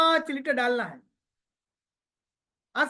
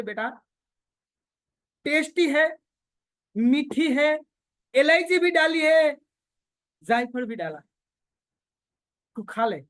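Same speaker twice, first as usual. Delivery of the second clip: coaxing mother; 3 semitones higher, 4 dB louder than usual. Retold or imitated. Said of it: "माँ उसको काला वाला कर रही है".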